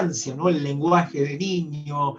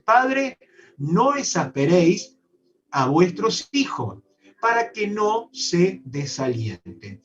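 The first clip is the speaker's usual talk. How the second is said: preaching scripture; 4 semitones higher, 4 dB louder than usual. Acted. Preacher proclaims scripture verse.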